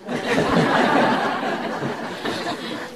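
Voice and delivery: laughs at own joke, under the breath; breathy laugh. Real, not acted.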